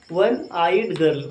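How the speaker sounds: each word drawn out slowly for copying; slow dictation. Shown as a demonstration. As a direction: spell it out slowly so they can write. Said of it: "क्या लिखा हमने?".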